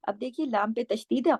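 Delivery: ordinary talk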